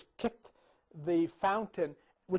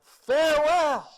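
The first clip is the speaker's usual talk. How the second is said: high, drawn-out dying scream; 9 semitones higher, 11 dB louder, slower than usual. Acted. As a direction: bellow a last goodbye, long and loud. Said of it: "he screamed out".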